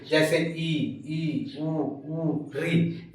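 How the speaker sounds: soft, held ई and उ with lips barely open; close vowel demo. Shown as a demonstration. Teacher demonstrates close vowels ई, उ to students. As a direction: sound ई and उ softly, mouth nearly closed. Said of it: "समृत स्वर के example हमारे ये है".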